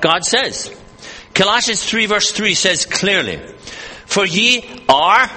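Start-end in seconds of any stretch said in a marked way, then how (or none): 4.0-5.3 "are" stretched and left rising; slow down and hold "are" up; long, lifted "are" to mark the tense; stress on ARE. Shown as a demonstration. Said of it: "present tense".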